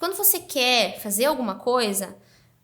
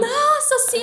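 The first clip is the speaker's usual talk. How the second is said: high, loud retold squeal of delight; imitating an overjoyed reaction; excited acceptance; 12 semitones higher, 6 dB louder, slower than usual. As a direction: burst out with a thrilled, high-pitched yes. Retelling an overjoyed yes.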